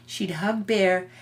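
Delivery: ordinary delivery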